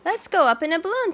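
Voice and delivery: high, loud, fast excited mom; high, quick, loud excitement; eager Mama. Acted. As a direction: rush it out with delight. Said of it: "says Mama".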